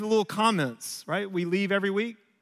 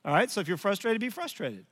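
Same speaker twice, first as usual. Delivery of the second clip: muttered, softer smug self-justification; self-justified; 5 semitones lower, 5 dB quieter than usual. Retold or imitated. Dad justifying himself after son ignores advice, softer. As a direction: sulk it out under your breath. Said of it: "I kinda am self-justified".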